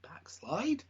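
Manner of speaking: whispered rising question; hushed disbelief. Acted. Co-host echoes the surprise finish, hushed disbelief. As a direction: whisper it as if you can't believe it.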